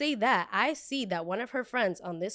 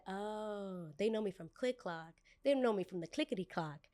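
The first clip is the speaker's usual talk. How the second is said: much softer, as it dawns on you; hushed realization; 9 dB quieter than usual. Retold or imitated. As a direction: drop the volume right down, knowingly. Sudden realization she is recognized.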